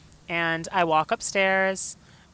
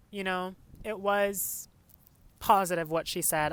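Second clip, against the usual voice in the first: ordinary talk, nothing marked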